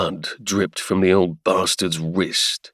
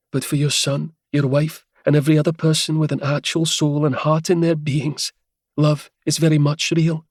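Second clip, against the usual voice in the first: higher woman's voice, calm and cutting; a cool, higher-voiced rebuke; calm Helia; 7 semitones higher than usual. Helia coolly condemns the Duke in a calm, higher woman's voice. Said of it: "The quiet calm in Helia's voice".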